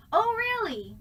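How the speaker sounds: high, loud "Oh, really?" thrown across the room; projected call. Shown as a demonstration. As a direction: call "Oh, really?" to someone far off. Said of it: "So you have to go".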